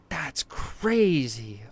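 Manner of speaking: slow, breathy whispered disbelief; awed whisper. Real, not acted. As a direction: whisper it in awe. Boss just killed.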